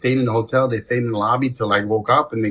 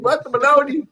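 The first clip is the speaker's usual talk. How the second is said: mock confusion; 13 semitones higher than usual. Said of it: "I'm like".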